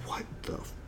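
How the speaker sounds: whispered in disbelief; stunned whisper. Retold or imitated. The audience reacts to the speaker, in a shocked whisper. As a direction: mouth it to your neighbour, shocked. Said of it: "everybody's looking at each other like".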